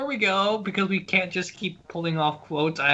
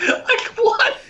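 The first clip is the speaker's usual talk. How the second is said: laughing. Real, not acted. Laughing hard at joke.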